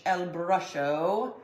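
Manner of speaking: slow, drawn-out joke name; silly mock Spanish. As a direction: stretch it out like a goofy Spanish title. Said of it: "I don't know why I just said it like that".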